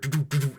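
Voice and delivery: rhythmic "du-du" mouth noise; quick stuttered train-track clatter; imitated clacking over tracks. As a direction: mimic train wheels on the rails. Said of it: "and then you just hear".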